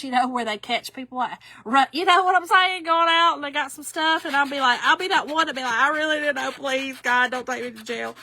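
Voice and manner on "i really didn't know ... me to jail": pleading in pretend panic, voice lifted at first; mock pleading. Shoplifter begging police not to take her to jail, mock pleading. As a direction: beg like you've been caught, half in play.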